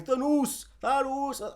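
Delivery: "Thanos" drawn out as "thuh-NOOS"; mispronounced Thanos. Retold or imitated. Showing what he's saying, "Thanos" slowly as thuh-NOOS. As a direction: exaggerate the oo ending of "Thanos". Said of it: "He's saying Thanos".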